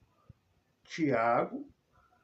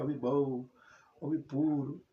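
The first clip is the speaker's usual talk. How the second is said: normal talking voice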